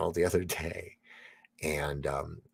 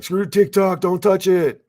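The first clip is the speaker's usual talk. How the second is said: high, loud put-on voice; mock dubbed actor; 11 semitones higher, 9 dB louder, slower than usual. Reading a chat line in a dubbed-movie voice, high and loud. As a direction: chant it like a dubbed hero. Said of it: "Phoenix is like".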